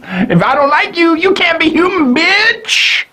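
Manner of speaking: high put-on voice, rising to a sassy "bitch"; snotty, exaggerated Unico; mock Unico. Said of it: "He doesn't say it like that".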